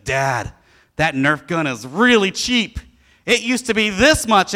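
imitating his son's excitement; excited kid. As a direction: show dad the bargain with glee. Father voices excited son.

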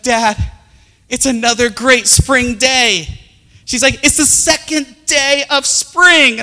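put-on high, loud, slow gushing child; excited daughter. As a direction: gush like an eager little girl.

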